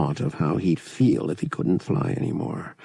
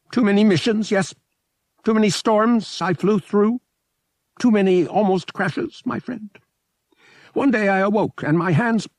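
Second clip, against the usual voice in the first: high, louder small alien voice, weary and unhappy; a sad small alien pilot, pitched up high; mournful little alien; 4 dB louder than usual. A narrator's reading. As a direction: fragile alien pilot lamenting, high and pressed. Sad alien pilot recounts woes.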